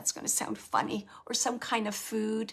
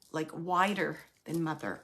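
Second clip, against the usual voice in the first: ordinary delivery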